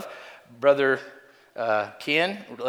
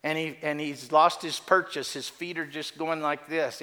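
ordinary talk, nothing marked

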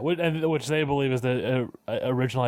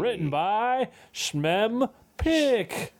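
slow drawn-out; 9 semitones higher, much slower than usual. Pretend. Joke credit read, mangled name.

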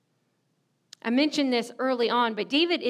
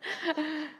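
short laugh; 4 semitones higher, 7 dB quieter than usual. Real, not acted. Laughing at her own lie.

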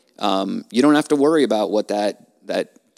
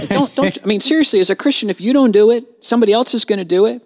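urgent pleading; 10 semitones higher, 4 dB louder than usual. Real, not acted. Urging listeners to do jury duty.

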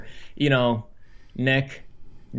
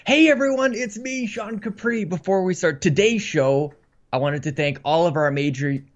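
bright host intro, higher; upbeat podcast host opening; show intro voice; 4 semitones higher than usual. Acted.

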